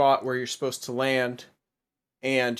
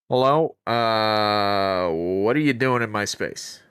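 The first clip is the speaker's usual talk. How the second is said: plugging nose voice; 3 semitones lower, 3 dB louder, slower than usual. Acted. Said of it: "Are you plugging your nose while you do that voice?".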